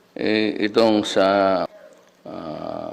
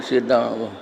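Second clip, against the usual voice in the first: ordinary talk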